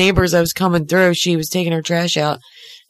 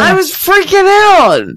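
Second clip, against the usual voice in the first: higher and louder, freaking stressed; excited outburst; 12 semitones higher, 6 dB louder than usual. Real, not acted. Telling friends about the raccoon, worked-up outburst. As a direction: blurt it out all worked up.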